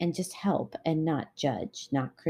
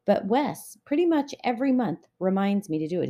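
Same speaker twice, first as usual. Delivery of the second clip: ordinary talk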